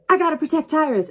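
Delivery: alarmed. Acted. Panicked at thunder.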